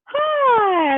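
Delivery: very high, drawn-out hello; high mock-friendly greeting; imitated idol greeting. Lead singer greeting a frozen fan, high drawn-out imitation.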